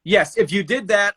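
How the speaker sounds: ordinary talk, nothing marked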